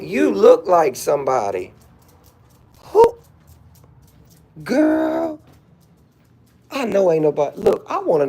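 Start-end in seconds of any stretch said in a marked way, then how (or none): 2.8-5.4 high squeal of disbelief; incredulous shriek. Real, not acted. Incredulous outburst.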